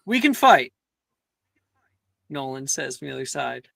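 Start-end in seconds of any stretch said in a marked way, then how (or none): 0.0-0.7 high, loud fighting challenge; acted challenge. Nolan calling from the other side for a fight, acted challenge. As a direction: call them out, pitched up and forceful.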